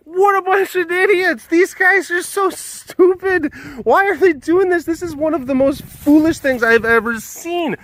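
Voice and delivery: very high-pitched mocking crowd voice; over-the-top ridicule, imitating bystanders; jeering onlookers. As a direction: jeer in a hammed-up squeaky voice. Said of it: "everyone looks and they say".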